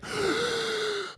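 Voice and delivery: sharp, very high gasp of amazement; excited gasp. Retold or imitated. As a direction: gasp like you just saw a miracle play. Awed at a wild trick play.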